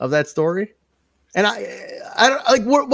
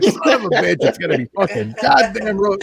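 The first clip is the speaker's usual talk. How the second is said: worked-up rant; 5 semitones higher than usual. Retold or imitated. Retelling his reaction to the storyline, worked up. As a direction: replay an exasperated cursing fit. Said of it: "I get all worked up".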